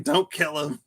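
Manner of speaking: high, giggly plea; mock pleading. Teacher begging the class to spare the bee, mock plea. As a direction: squeak it through laughter.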